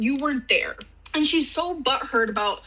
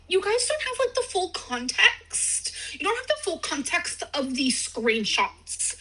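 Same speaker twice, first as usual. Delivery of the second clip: mocking Rachel's accent; 7 semitones higher than usual. Mocking Rachel's video complaint in a much higher put-on voice. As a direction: put on Rachel's whiny video voice to mock her. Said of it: "that's her trying to do the accent".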